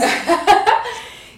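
loud, high burst of laughter. Real, not acted. Friend laughs at the pancakes joke, loud high laughter.

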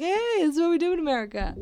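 high, fast cheerful announcement; cheery tourist line. Retold or imitated. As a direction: brag brightly to the family. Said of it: "and I'm like".

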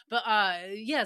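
ordinary delivery

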